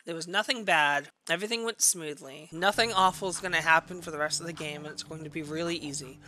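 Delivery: low, soft, falsely serene voice; hushed, low-pitched pretend composure; mock calm. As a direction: reassure yourself quietly, in denial. Pretends all went smoothly.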